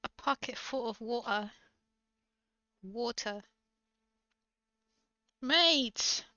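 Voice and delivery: put-on playful British accent, phrase by phrase. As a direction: show off stock British-accent words. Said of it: "get better at emulating different accents".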